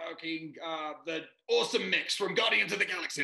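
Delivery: loud, high yelled demand while pushing; mock yell from the delivery bed; wife yelling mid-labour. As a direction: shout it out mid-contraction. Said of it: "she would go".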